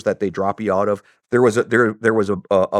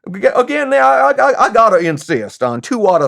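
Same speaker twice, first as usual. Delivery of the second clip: high, stammering mock Southern voice; put-on Southern accent, rising loud and high; 9 semitones higher, 4 dB louder than usual. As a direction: insist like a high-pitched Southern gent. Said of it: "I don't have a very good Southern accent".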